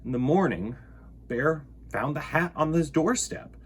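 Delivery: ordinary delivery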